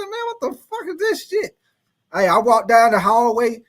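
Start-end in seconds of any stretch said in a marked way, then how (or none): 0.0-1.6 high, squeaky mock panic; startled high yelp, half joking; retold alarm. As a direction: squeal it like you're in a horror movie. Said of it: "I said".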